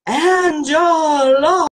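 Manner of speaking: Angela in a squeaky, very slow, loud wail; hammed-up cartoon squeal of Angela; exaggerated cartoon voice. Shown as a demonstration. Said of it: "You can do it in a Mickey Mouse voice".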